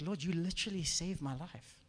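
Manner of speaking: softly retold prayer, no actual crying; subdued gratitude. Man thanking the Lord after breaking down, subdued. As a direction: say it quietly, in understated awe. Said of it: "I just broke down crying".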